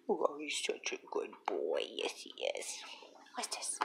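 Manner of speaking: whispered cooing to the cat; soft whisper to pet. Real, not acted. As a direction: whisper lovingly to the kitten. Talking to cat softly.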